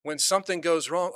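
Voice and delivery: ordinary talk, nothing marked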